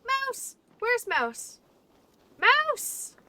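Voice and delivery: loud excited call for the dog. Real, not acted. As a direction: shout the name across the room. Calling out for the dog.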